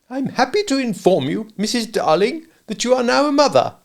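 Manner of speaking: child mimicking a pompous father, high; a boy putting on his father's grand announcement. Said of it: "in just such a tone as Mister Darling himself may have used".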